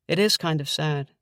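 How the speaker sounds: plain reading voice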